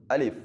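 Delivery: الف said with a firm, tight grip; firm alif. Shown as a demonstration. Teacher showing a firm الف. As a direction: say الف strongly, not loosely. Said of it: "مضبوطی کے ساتھ ... الف کو پڑھنا ہے".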